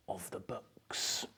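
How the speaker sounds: soft, slow breathy whisper of delight; hushed whisper. A narrator's reading. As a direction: lean in and whisper it like a secret treasure. Savouring the smell of books.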